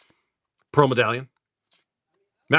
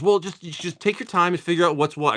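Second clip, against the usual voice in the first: imitated wife; 8 semitones higher than usual. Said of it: "She goes".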